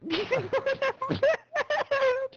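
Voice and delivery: shrieking high laugh. Real, not acted. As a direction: burst into squealing laughter. Drunk laughter at crude joke.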